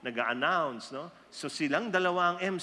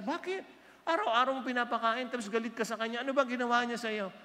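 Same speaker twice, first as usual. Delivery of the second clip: surprised husband; 7 semitones higher, faster than usual. Acted. Husband voiced puzzled in story.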